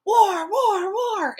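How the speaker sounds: high, loud screamed war cry; screaming. A narrator's reading. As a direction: scream it in a frenzy. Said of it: "We all started screaming with him".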